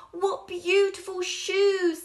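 high, loud and slow; delighted cry. Acted. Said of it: "they cried".

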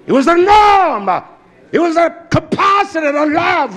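high, loud preacher's cry; fired-up sermon delivery; full-force preaching. Acted.

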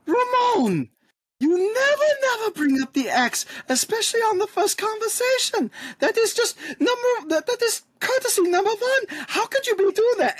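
very high scolding squeal. Acted. Dagny scolding Ramon for bringing up the ex, very high. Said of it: "he's be like".

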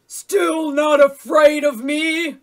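slow, huge wolf bellow; high-pitched, very loud monster roar of words. A narrator's reading. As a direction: bellow it as a menacing challenge. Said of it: "the big bad wolf bellowed".

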